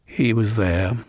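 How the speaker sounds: usual speaking voice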